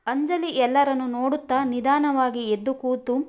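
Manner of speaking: plain reading voice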